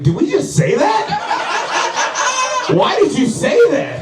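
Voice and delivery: high-pitched, incredulous inner voice; alarmed inner monologue. Acted. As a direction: question yourself in high-pitched disbelief. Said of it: "my inner monologue was like".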